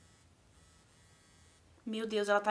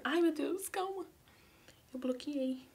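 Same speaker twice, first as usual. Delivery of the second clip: ordinary talk, nothing marked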